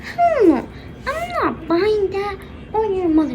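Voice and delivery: high-pitched outraged no; acted fed-up parent; indignant refusal. Dad refusing daughter's plea.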